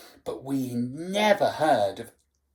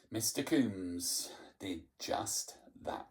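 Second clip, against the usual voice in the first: ordinary delivery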